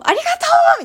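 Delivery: very high, very loud laughing thank-you; ecstatic shout. Retold or imitated. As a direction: yell your thanks to the sky, laughing. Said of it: "叫んじゃうの". (笑いながら高く大声で叫ぶ)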